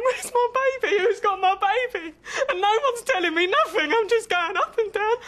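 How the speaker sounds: put-on high, loud, crying out for her baby; frantic crying. Mother searching the ward, crying in desperate panic.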